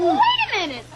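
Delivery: urgent protest. Acted. Condemned man protests.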